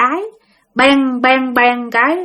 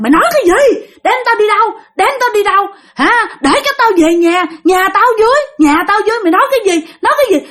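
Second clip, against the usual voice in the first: put-on furious screaming girl; 11 semitones higher, faster than usual. Possessed girl screams at the old woman, furious. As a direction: scream it at her like a possessed child.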